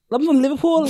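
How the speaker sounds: high, laughing attempt at Scouse; Liverpool accent. Shown as a demonstration. Showing mates a Liverpool accent, giggly put-on Scouse. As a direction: put on Scouse while cracking up. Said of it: "do a Liverpool accent".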